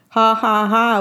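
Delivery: ordinary delivery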